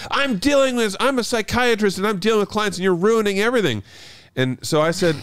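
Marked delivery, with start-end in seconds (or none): 0.1-3.8 high-pitched mock outrage; imitated indignant complaint. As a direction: mimic an exasperated neighbour in a raised voice. Retelling the indignant neighbour's complaint.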